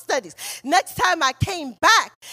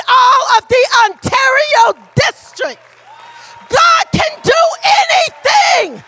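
loud, high, slow, tearful shout; preaching climax; 6 semitones higher, 7 dB louder, slower than usual. Acted. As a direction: stretch it out with a crying break.

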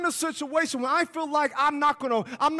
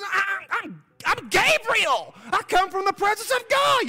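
commanding angel; 7 semitones higher than usual. Shown as a demonstration. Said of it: "He didn't say it like that. He says".

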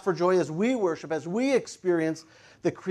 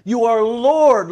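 slow, loud declaration; emphatic praise, building louder; angels proclaiming; 4 semitones higher, 8 dB louder, slower than usual. Acted. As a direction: proclaim it with deliberate weight on each word. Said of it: "So they're saying".

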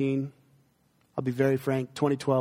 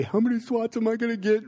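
mock anxious kid voice, much higher; worried child; 8 semitones higher than usual. Pastor imitating his son asking about punishment. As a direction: lightly play the fretting little boy. Quoting son Joby's question.

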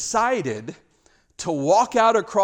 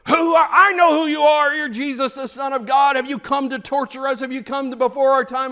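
high-pitched possessed outburst, loudest at the start; demon-possessed person; 7 semitones higher, 4 dB louder than usual. Retold or imitated. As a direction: imitate a possessed man crying out in challenge. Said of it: "this demon-possessed person would come up and say".

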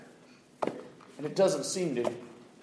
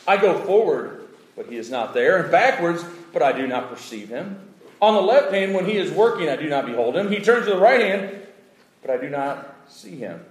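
raised, declaiming Bible read; scripture reading; 7 semitones higher, 7 dB louder than usual. Acted. Preacher reading Job's lament to the church, declaiming. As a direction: proclaim the verse to the hall.

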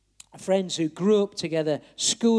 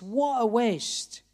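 retold shout; 3 semitones higher, much slower than usual. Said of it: "he was shouting at the top of his voice".

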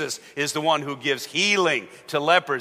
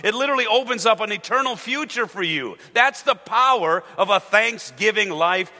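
raised, ringing preacher's call; fervent preaching; 6 semitones higher, 4 dB louder than usual. Acted. Pastor proclaiming faith to the congregation, fervent and raised.

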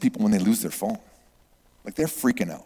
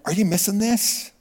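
high, rising disbelief; acted bewildered appeal; incredulous question; 8 semitones higher than usual. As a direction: ask it as if astonished they don't notice. Acts his reaction to oblivious campers.

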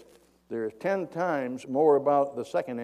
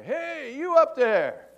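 high, loud call up to someone far above; acted long-distance shout; yelling up; 12 semitones higher, 7 dB louder, slower than usual. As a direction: holler up to a man on a bridge. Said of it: "he yells up to this guy".

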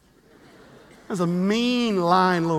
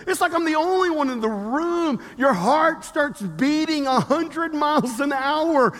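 raised fervent preacher voice; preaching; 4 semitones higher, 4 dB louder than usual. Acted. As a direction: preach it with building urgency. Preaching at force.

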